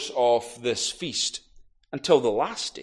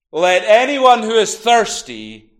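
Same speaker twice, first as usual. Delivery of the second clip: loud, high call across a hushed crowd; booming call; 6 semitones higher, 10 dB louder, slower than usual. Acted. Jesus calls out booming to the hushed crowd. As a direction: ring it out to the whole temple. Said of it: "Jesus' voice booming".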